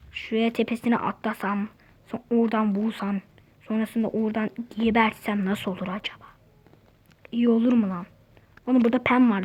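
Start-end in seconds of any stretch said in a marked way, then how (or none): 0.1-6.3 soft plotting whisper to yourself; scheming whisper. Real, not acted. Gamer plotting a sneak kill, whispering.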